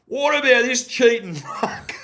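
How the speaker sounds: high, laughing shout down the mic; half-laughing, yell it like heckling the ref over the PA; retold yell, laughing. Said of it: "I'm yelling into the microphone".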